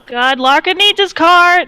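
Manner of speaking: loud, high alarmed yell; shouting guard. Acted. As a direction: yell the news up the line. Guard shouting news to other guards.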